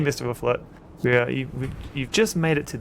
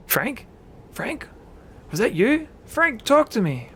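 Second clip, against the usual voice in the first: high, anxious calling; crying out; 12 semitones higher, 4 dB louder than usual. Acted. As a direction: call out for a hurt friend, voice way up. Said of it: "William Mayfield cries out".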